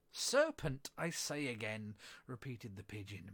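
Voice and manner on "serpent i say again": usual speaking voice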